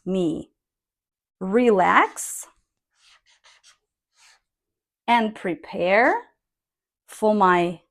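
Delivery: slow read with pauses between phrases; careful intonation demo; intoned reading. Shown as a demonstration. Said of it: "I intoned, if you can see".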